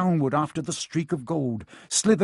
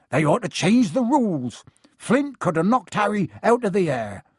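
higher, indignant gruff-friend character; indignant Hagrid; 5 semitones higher, 4 dB louder than usual. A narrator's reading. Hagrid taking Dean's side about the rules, indignant. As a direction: protest it with feeling, pitched up.

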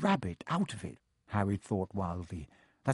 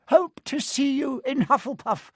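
much higher, louder, hopeful; jolly booming friar; 5 dB louder than usual. A narrator's reading. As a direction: beam at the newcomers. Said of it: "said the friar".

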